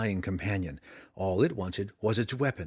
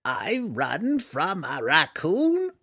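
slow, higher creature voice in disbelief; laughing gnoll voice; 7 semitones higher, 4 dB louder, much slower than usual. A narrator's reading. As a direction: scoff at your own fear, slowly. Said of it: "and it laughed".

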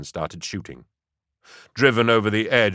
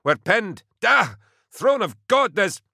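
high, loud, panicked cry cut off mid-word; panicked shout; 13 semitones higher, 3 dB louder than usual. Acted. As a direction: scream for help under fire. Pinned down, screaming over comm.

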